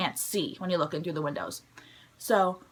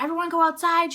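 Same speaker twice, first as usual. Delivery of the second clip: higher-pitched mock announcement; lightly voiced loudspeaker announcement; principal on loudspeaker; 7 semitones higher than usual. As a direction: imitate a principal calling out orders. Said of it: "the principal on the loudspeaker".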